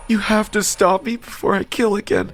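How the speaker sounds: high-pitched, distraught begging; anguished high confession; desperate tearful plea. A narrator's reading. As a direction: plead in a high sobbing voice. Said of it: "I heard the same sobbing sound".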